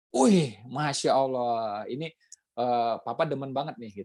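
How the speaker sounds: slow, drawn-out fake delight; hammed-up slow mock enthusiasm; mock delighted praise. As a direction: overpraise a bad dish, slowly. Husband praises bad cooking.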